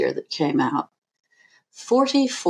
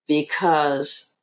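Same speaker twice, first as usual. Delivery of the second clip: ordinary talk, nothing marked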